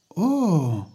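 put-on high surprised "oh", boyish figure. Peter reacts to his uncle's explanation, surprised.

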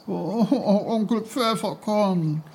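put-on high, wailing child's plea; nearly crying. A narrator's reading. Boy must go home and pleads with uncle, nearly crying. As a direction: wail it high, on the edge of tears. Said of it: "Peter weinte fast".

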